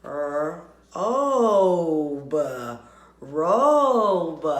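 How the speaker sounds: Robe split into R- and obe, then said whole and loud; R- cut, obe drawn out loud; blending sounds. Shown as a demonstration. Teacher sounding out robe for the class. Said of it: "let's blend the word robe".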